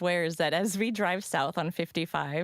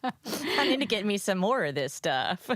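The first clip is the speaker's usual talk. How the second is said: put-on slow, higher-pitched country accent; playful Missouri accent; 4 semitones higher than usual. Host playing along with guest's wine reaction, Missouri accent. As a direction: draw it out in a mock Missouri twang.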